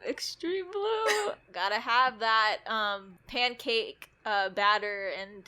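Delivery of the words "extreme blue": slow, high, hammed-up chant; excited. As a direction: stretch it out, high and grand. Quoting the show's line.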